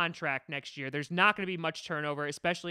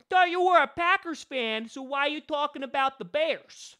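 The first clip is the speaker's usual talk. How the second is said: put-on Brooklyn-Queens accent, much higher and louder; 9 semitones higher, 7 dB louder than usual. Imagined fan challenges the host in a loud Brooklyn accent. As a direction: play a New York fan calling him out. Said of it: "I have this accent, like I'm kind of from Brooklyn".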